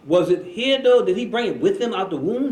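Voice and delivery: high, loud pressing question. Acted. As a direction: press the point, pitch and volume jumping up. Acted questioning about where knowledge came from.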